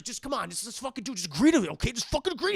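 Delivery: mock angry pleading; angry pushing. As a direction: push her hard, losing patience. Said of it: "he's like".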